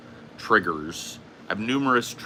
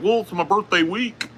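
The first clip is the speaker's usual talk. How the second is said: high, mocking birthday-boy voice; imitating Boogie; 10 semitones higher, 3 dB louder than usual. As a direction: pitch it way up to mimic an insistent friend. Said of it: "And he's like".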